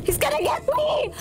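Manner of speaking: scared. Real, not acted. Horror game, chased by monster.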